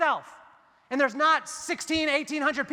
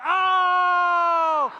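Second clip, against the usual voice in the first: loud, high ohh of shock; a room reacting to a burn; crowd's gasp; 5 semitones higher, 6 dB louder than usual. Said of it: "everyone was like".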